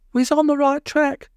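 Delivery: put-on high childlike call; Polly, a small girl, crying out eagerly; high little girl voice calling out. A narrator's reading. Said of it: "cried Polly".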